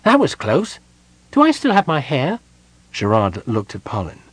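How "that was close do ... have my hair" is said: high-pitched small kender voice; high, rattled little character after a near miss; anxious kender. A narrator's reading. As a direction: ask it anxiously, far above your usual pitch. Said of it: "feeling his top knot anxiously".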